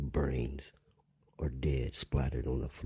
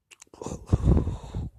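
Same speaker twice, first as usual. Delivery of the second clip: exasperated loud sigh; 7 dB louder than usual. Real, not acted. Frustrated break mid-sentence.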